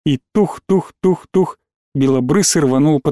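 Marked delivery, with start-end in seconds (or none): 0.3-1.6 evenly spaced thudding syllables; imitated footsteps. A narrator's reading. Narrator mimics boy running down path, imitated footsteps. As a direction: chop out four separate thuds.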